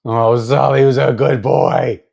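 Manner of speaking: gushing pet talk. Real, not acted. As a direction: gush slowly and loudly at a beloved pet. Breaks off to praise pet, baby-talk.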